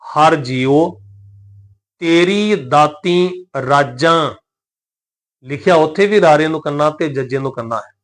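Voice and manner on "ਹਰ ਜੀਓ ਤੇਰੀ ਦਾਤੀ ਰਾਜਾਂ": slow, measured line ending in nasalised ਰਾਜਾਂ; nasal-ending ਰਾਜਾਂ. Shown as a demonstration. Teacher reading scripture to congregation, nasal-ending ਰਾਜਾਂ. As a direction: draw it out, nasalising the last vowel of ਰਾਜਾਂ. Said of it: "ਬਾਣੀ ਵਿੱਚੋਂ ਆਹ ਤੁਕ ਪੜਾਂਗੇ".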